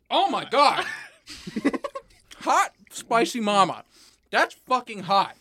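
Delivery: high, loud pained exclamations; burning mouth outcry. Real, not acted. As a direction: cry out from the spicy burn. Reacting to the chip's heat.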